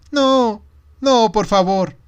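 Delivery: put-on high, loud pleading child; niño suplicante. A narrator's reading. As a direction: beg like a frightened little boy. (niño suplicando, agudo)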